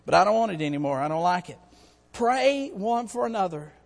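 ordinary talk, nothing marked

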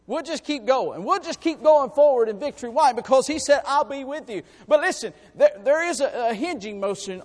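from steady to loud proclamation; building preacher's declaration. Acted. Preacher assuring the church nobody can defeat God, building. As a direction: build the certainty up to a shout.

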